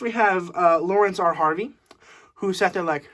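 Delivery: ordinary delivery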